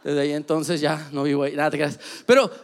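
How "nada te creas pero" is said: ordinary talk, nothing marked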